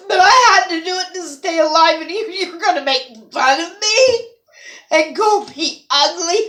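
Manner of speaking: high, loud voice cracking between laugh and sob; sobbing outrage at being mocked; tearful anger. Real, not acted. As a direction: cry out the hurt at him. Crying, angry at the mocker.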